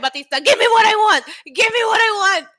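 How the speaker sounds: high, loud fan yelling at the screen; hammed-up screaming for a wish; screaming demand. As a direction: re-enact a frantic, impatient shout. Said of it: "I'm screaming at my monitor".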